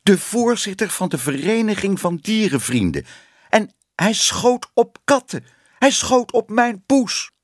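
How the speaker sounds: high, indignant outrage building to a cry; scandalised. A narrator's reading. Councillor's wife denounces the cat shooter, scandalised and high. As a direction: build from shocked disbelief to outcry, pitched high.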